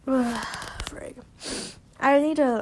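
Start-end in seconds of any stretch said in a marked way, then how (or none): none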